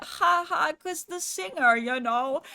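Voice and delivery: loud, high, drawn-out fake gushing; mock amusement. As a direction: imitate someone tickled by their own joke. Mimicking strangers laughing at coincidence.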